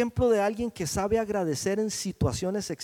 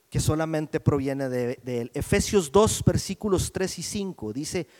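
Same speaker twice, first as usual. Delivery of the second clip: normal talking voice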